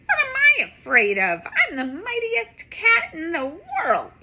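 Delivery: confident. Acted. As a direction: puff up with courage. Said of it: "Streaky thinks out loud".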